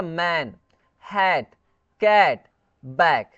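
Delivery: "मैन, हैट, कैट, बैक" each with an open short "ae" vowel; short A sound. Shown as a demonstration. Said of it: "ए पढ़ेंगे".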